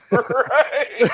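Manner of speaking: right, then a loud, high laugh; high burst of laughter. Real, not acted. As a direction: break into real laughter, pitch jumping up. Host bursts out laughing at joke.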